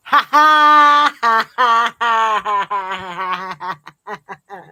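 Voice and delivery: big laugh that fades out; loud laughter. Real, not acted. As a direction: laugh hard, then let it die down. Real burst of laughter.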